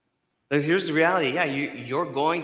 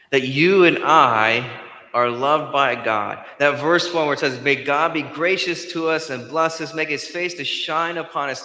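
preaching at full force; 2 semitones higher, 6 dB louder than usual. Acted. Preacher to congregation on God's love, full force. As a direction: drive it home from the pulpit. Preaching the good news loudly.

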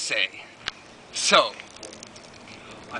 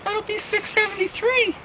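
very high falsetto cashier; cashier's voice. Retold or imitated. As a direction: squeak the price like a cashier. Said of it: "the lady behind the counter was like".

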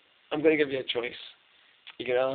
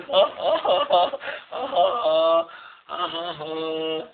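drawn-out giggling laugh; long laugh. Real, not acted. Laughing at his drunk mate's bedtime rant, giggling. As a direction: laugh it out helplessly.

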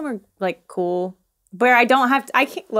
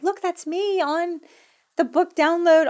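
tag; 8 semitones higher, slower than usual. Retold or imitated. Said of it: "you're all excited".

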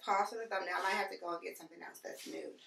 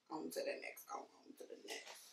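whispered transition line; whispering; 12 dB quieter than usual. Real, not acted. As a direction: breathe it out in a whisper. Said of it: "whispered sign-off".

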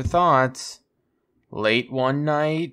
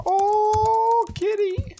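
very high, drawn-out falsetto coo; high-pitched cooing; much slower than usual. Real, not acted. Player spotting a kitty, very high cooing. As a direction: coo sky-high at a cat.

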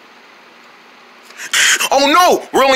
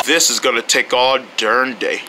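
ordinary talk, nothing marked

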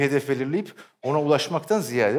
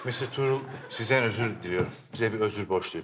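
mock apology; 6 semitones lower, 5 dB quieter than usual. Mock apology scene, director coaching.

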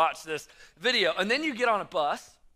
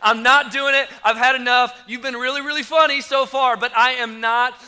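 1 loud panicked refusal; 4 semitones higher, 10 dB louder than usual. Retold or imitated. Refusing to go down rapid.

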